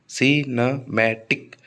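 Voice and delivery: सिनेमैटिक slowly divided as si-na-mai-tik; syllable by syllable. Shown as a demonstration. Teacher repeating the four-syllable count for students, सिनेमैटिक syllable by syllable as si-na-mai-tik. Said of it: "चार syllable हैं".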